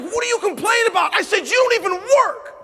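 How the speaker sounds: high, loud snapping shout; retold angry shout. Husband shouting back at his complaining wife. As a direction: hit back hard and loud. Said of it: "I was like".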